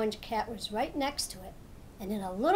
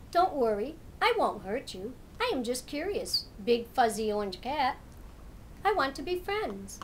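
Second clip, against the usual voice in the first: friendly cat. Acted. Big cat befriends the turtle, gently. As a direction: reassure the shy turtle as a kind cat.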